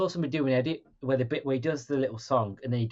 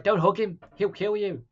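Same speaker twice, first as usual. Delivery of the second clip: shouting at the screen; 7 semitones higher than usual. Retold or imitated. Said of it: "and I'm like".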